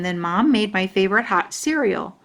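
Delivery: plain reading voice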